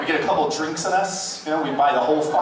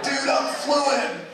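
put-on very high, slow drunk boast; slurry mock brag; drunk bragging friend; 12 semitones higher, much slower than usual. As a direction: stretch it out like a wasted know-it-all. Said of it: "You got that friend like".

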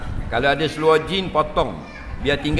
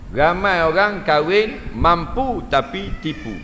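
usual speaking voice

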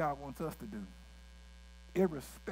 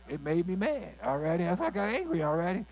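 louder, a hammed-up huffy defense; mock angry churchgoer; 2 semitones higher, 6 dB louder than usual. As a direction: imitate someone justifying their temper. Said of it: "You say".

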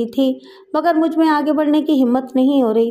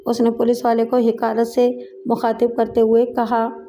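ordinary delivery

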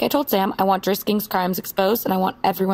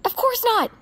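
much higher, incredulous of course not; scoffing; 13 semitones higher than usual. Acted. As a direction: toss it off as obvious. Scoffs at trusting her source.

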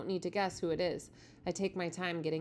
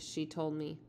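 ordinary delivery

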